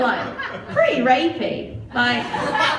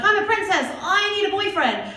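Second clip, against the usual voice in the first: princess; 7 semitones higher than usual. Retold or imitated. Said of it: "she's like".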